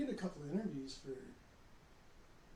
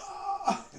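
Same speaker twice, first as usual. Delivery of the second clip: high yelp with a laugh; mock scream of pain from a cramp; sudden cry from a cramp waking him at night; retold scream; 8 dB louder than usual. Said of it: "I came out screaming".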